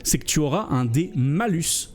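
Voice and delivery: normal talking voice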